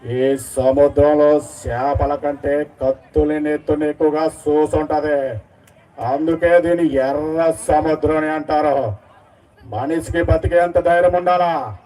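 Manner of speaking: low, very slow, menacing hero voice; mimicking the Devara hero's heavy speech; Jr NTR in Devara. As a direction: deliver it slow and low like a brooding warlord. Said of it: "junior NTR గారి voice Devara movie నుంచి".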